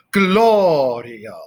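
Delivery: GLO of Gloria leaned on loud and long; first-syllable stress. Shown as a demonstration. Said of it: "emphasis on the first syllable".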